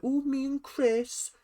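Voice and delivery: high, slow, cutesy mock coo; mock Ella swooning, sweet and high. Vlogger mocks Ella's crush in high cutesy coo.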